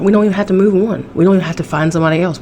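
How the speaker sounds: quick, breezy rant about not needing anyone; shrugging it off at speed; fast rant. Real, not acted. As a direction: rattle it off fast and dismissive. Ranting about moving on.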